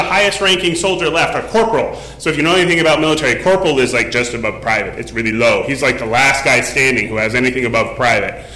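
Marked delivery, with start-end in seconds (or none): none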